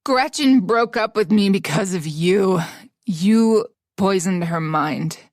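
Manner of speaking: very slow, heavy and menacing; seething rage. Acted. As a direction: grind out the threat. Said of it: "he raged".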